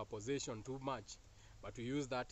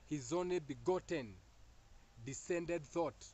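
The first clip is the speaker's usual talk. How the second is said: slow emphasis; slower than usual. Real, not acted. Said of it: "emphatic slow delivery".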